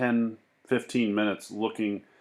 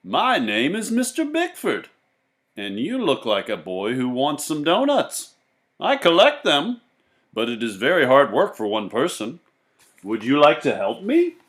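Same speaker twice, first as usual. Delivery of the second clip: put-on higher, louder salesman voice luring a boy; jolly donut man; 7 semitones higher, 11 dB louder than usual. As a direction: pitch it like a friendly stranger with a wagon. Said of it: "said the man".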